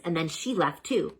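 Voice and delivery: ordinary delivery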